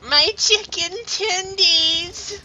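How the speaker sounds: high, slow, loud squeal; drawn-out silly high voice; bad acting. Goofy shouted line.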